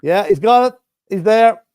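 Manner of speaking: loud, high celebratory shout; excited try call. Real, not acted. Fan sees South Africa score, excited shout.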